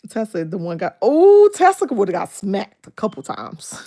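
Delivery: ordinary talk, nothing marked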